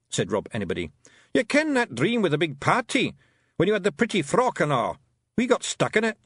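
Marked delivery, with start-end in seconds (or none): 1.3-6.2 raised gruff little Scots pixie, pleased with himself; boastful wee Feegle, a bit louder; Rob Anybody's Scots voice. A narrator's reading. Rob Anybody boasting to Tiffany after the fight, Scots pixie voice. Rob's voice.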